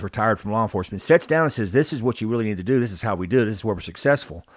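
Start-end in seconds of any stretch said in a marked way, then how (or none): none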